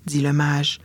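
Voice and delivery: ordinary delivery, nothing marked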